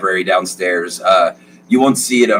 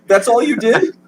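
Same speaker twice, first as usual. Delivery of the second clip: beaming father voice, much higher; proud dad. Acted. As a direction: gush over your son. GM plays the father.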